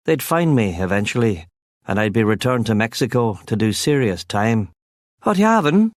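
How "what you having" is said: high Cork lilt asking an order; young barman's high Cork voice. A narrator's reading. Barman asks the narrator's order, high Cork lilt.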